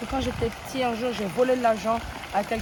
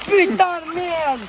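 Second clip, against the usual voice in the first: mock outburst; 6 semitones higher, 4 dB louder, slower than usual. Cursing the man who robbed him.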